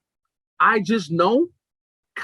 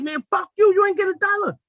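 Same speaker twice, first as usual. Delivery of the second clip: high-pitched, heated comeback; acted angry retort; 13 semitones higher than usual. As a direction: play the passenger shouting her down. Acts out the man arguing back.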